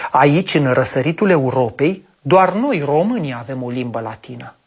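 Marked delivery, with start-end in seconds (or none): none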